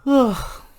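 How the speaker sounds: a long open-mouthed yawn. Real, not acted. Crafter yawning while talking to viewers, a long yawn. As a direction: let a real yawn slip out mid-sentence. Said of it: "I'm yawning".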